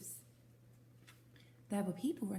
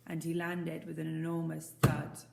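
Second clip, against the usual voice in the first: ordinary delivery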